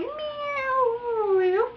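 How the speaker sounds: high, long falling "meow" whoosh; drawn-out high vroom noise; imitated car zoom. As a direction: mimic a car swerving past.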